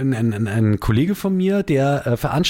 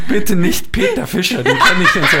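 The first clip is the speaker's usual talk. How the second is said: pleading dismay; 7 semitones higher, 6 dB louder than usual. Retold or imitated. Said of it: "Ich so".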